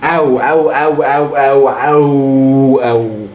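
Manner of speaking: hammed-up mock oh sound, slowed on the last; mimicking game's oh. Gamer mocking the game's death sound, stretched mock oh. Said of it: "هيعمل لي أو".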